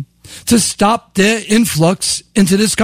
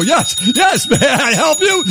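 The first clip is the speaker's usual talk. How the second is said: higher, chirpy desk-clerk greeting; mock hotel clerk; 4 semitones higher than usual. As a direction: play the eager front desk clerk. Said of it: "hitting this bell for real".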